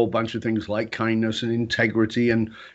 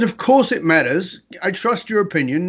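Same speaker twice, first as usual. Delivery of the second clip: higher-pitched, insistent retold protest; mild frustration; 6 semitones higher, 3 dB louder than usual. Husband pressing his wife for her score, mildly frustrated. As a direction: press the point with growing exasperation. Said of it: "Now I'm getting frustrated".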